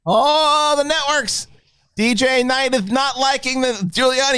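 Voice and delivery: high, indignant rant about the networks; hammed-up outrage at the networks; mock outrage. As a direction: rise up high in fake indignation.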